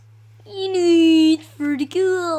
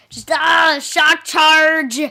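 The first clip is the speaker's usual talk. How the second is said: loud battle cry; 2 semitones higher, 9 dB louder, much slower than usual. Shown as a demonstration. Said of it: "I like how I say that".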